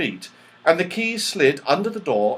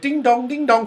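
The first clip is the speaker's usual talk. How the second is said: high, louder happy ding dong; joyful chime; 12 semitones higher, 5 dB louder than usual. A narrator's reading. The clock chimes happily when Silky hugs it, high and loud. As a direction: ring out with glee at the hug. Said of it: "quite loudly with joy".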